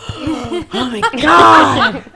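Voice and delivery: slow, loud, laughing oh my God; retelling the moment she saw her ex, laughing shock; laughing mock shock. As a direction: act out the panic of seeing your ex, half laughing. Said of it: "I just was like".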